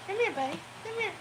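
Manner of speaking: high sing-song call to the dog; pet talk. Real, not acted. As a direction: coax the pup over in a high voice. Calling the dog over.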